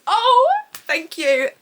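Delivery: loud, high, drawn-out mock gratitude; gushing thanks. Pretending to be welcomed to Velaris, gushing thanks. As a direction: swoon with thanks, stretching it out.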